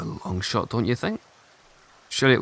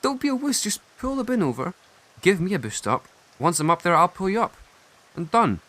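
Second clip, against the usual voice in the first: much higher and louder, eager and pushy; excited urging; 8 semitones higher, 4 dB louder than usual. Acted. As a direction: talk him into it like it's nothing. Scoffing, pushing his mate to boost him.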